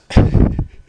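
loud breathy burst of laughter; real laughing fit. Real, not acted. Podcaster cracking up at own awkward answer, loud laugh. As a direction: crack up at your own story.

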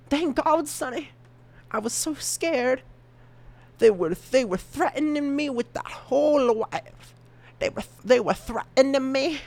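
high chipmunk voice; playful high squeaky character voice; squeaky voice changer. Acted. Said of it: "it sounds really squeaky".

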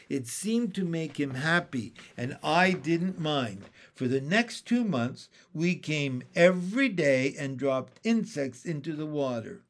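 usual speaking voice

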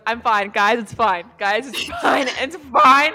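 loud laughing reassurance; laughing shout. Real, not acted. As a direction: yell it's fine through laughter. Laughing reassurance amid table panic.

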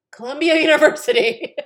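high, loud, laughing proclamation; proud mock announcement. Proudest first generation boast, loudly proclaimed with laughter. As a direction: trumpet the name with a laugh.

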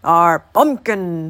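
put-on loud, slow old man's holler. A narrator's reading. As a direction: holler it, stretching the words. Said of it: "hollered the old man".

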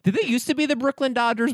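high, exaggerated New York voice; overdone Brooklyn twang; Brooklyn accent. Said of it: "This accent is nothing for me".

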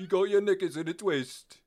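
over-the-top fake English scolding; mock British accent. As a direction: scold like a cartoon Brit. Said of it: "you can't sound badass saying stuff like that".

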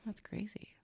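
soft unvoiced whisper. Real, not acted. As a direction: breathe it in a whisper. Whispered reaction.

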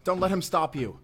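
desperate shout; urgent shout. Acted. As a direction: push them to move now. Urging partner during chase.

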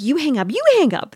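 high, teasing couple-on-the-phone imitation; mock lovebirds. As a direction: mimic sweethearts in a high voice. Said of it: "it's like".

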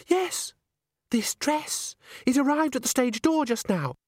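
put-on high, breathless-with-news girlish voice; gushing boast. A narrator's reading. Christine tells Agnes about her secret admirer, gushing. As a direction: swoop up high on the thrilling part.

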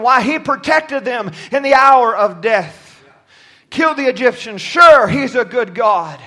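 impassioned pulpit proclamation; full-force preaching. Acted. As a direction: preach it with conviction, punching the key words.

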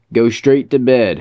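put-on loud sharp order to a child; shouting. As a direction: raise the voice, firm command. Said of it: "Heather shouted at Daisy".

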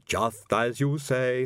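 slow, doubtful agreement. A narrator's reading. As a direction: let doubt ride on the words. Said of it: "doubt rode on the words".

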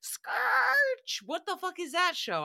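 hammed-up high baffled cry; baffled shriek. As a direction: shriek in disbelief. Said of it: "I was like".

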